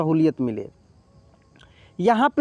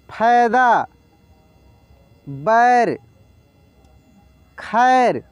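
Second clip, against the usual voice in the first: word-list reading; much slower than usual. Shown as a demonstration. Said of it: "शब्द को देखते ही direct बोलने".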